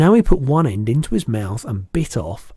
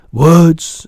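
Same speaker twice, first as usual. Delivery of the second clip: loud, higher giant character; rueful BFG; 6 semitones higher, 14 dB louder than usual. A narrator's reading. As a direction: lament lightly as a big giant.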